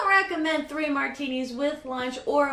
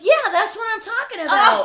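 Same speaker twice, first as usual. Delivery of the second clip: laughing; 7 semitones higher, 7 dB louder than usual. Retold or imitated. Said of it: "the guy goes".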